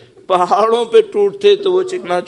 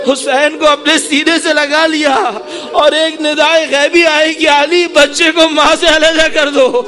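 high, loud mourning cry building to a peak; full-force lament preaching; 9 semitones higher, 6 dB louder than usual. Real, not acted. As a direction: wail the martyrdom out to the congregation.

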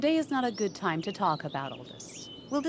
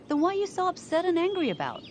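much higher, exasperated challenge; 10 semitones higher than usual. Acted. Guardian challenging the upset mother, pitched much higher in exasperation. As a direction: voice jumps up in disbelief. Guardian loses patience in argument.

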